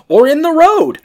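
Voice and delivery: loud, high-pitched yelped retort; mock outburst. [name]'s retort about the honeymoon, mock yelling. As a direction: blurt it out in a high mock shout.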